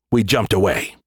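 ordinary delivery

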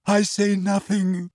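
slow, high, tearful; choked. A narrator's reading. Trelawney choking back tears before the class. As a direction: force the words through tears. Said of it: "she choked".

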